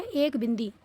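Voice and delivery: ordinary talk, nothing marked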